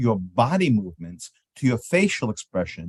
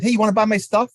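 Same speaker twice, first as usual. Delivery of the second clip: pushy sales pitch; 8 semitones higher, 5 dB louder, faster than usual. Shown as a demonstration. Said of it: "That's just rude".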